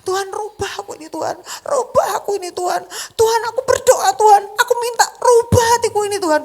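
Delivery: loud, high pleading repeated; retold desperate pleading, high and loud; fervent repetitive prayer. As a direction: keep begging God over and over. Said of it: "cuma itu doang, itu terus".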